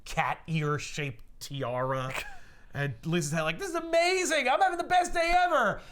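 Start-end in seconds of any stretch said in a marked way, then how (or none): 3.6-5.8 very high, loud excited squeal; thrilled little girl. Retold or imitated. Girl given a tiara, imitated squeal of joy. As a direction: shriek with joy like an overjoyed kid.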